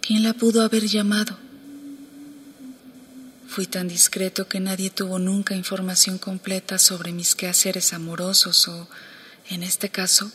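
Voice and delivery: hushed story reading; quiet, confiding narration. A narrator's reading. Reads novel excerpt, hushed.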